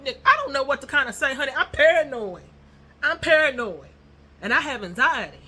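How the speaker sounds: fast, loud, high babbling; flustered panic. Retold or imitated. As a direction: gush nervously in a rush. Panicking at meeting a fan.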